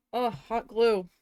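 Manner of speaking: loud, exasperated groan of a cry; loud exclamation. Real, not acted. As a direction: blurt it out in frustration. Exclaiming at hot glue.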